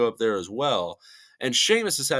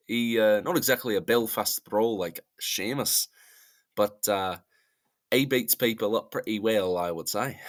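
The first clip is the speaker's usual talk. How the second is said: attempted Scottish accent. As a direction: put on a Scottish brogue as a joke. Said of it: "I need to do my accent".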